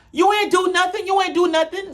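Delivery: mock her high and teasing; high mocking taunt. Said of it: "And it's, um".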